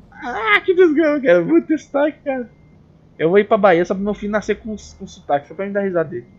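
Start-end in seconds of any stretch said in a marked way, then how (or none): 0.1-2.5 mock wail. Vlogger envying the Baiano accent, mock tearful wail. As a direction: whine it high as if in tears, jokingly.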